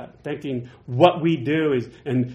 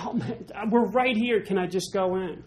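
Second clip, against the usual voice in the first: higher, begging; pleading Moses; 6 semitones higher than usual. Retold or imitated. Moses begging God to cross, higher pleading. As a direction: plead like a kid at the gate. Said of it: "He's like".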